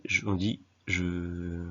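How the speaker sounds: ordinary talk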